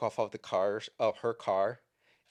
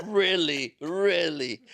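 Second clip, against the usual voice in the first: higher, louder, laughing it out; half-laughing mock disbelief; 8 semitones higher, 8 dB louder, slower than usual. Chaplain laughing back at the deputy's excuse, higher and louder. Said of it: "And I was like, laughs".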